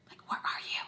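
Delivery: urgent whisper, no voice at all. Retold or imitated. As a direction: whisper it so nobody nearby hears. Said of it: "trying to whisper".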